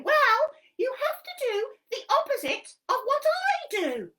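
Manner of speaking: high, loud cheeky monkey voice; squeaky puppet monkey helping Helen. Acted. Monkey puppet explaining a game to Helen, high and bouncy.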